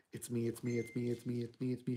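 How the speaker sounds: soft, low, tender repeating of it's me; warm, gentle woman. Acted. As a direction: hush each "it's me" like a comforting mother. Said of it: "a warm, gentle one".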